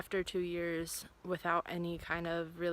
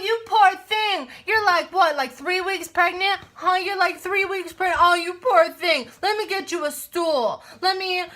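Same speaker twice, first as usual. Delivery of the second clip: much higher, louder, syrupy fake pity; mock sympathy; 10 semitones higher, 10 dB louder than usual. Coworkers cooing over pregnant Kirsten in mock sympathy. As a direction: coo over her like a fragile princess. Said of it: "everyone was like".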